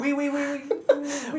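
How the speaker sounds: high mock French "we we"; bad French accent. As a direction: parody French oui oui, squeaky. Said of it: "worst French accent I've ever heard".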